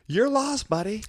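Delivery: high, taunting put-down; mocking tough guy. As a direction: sneer it like a smug bouncer. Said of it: "And I'm just like".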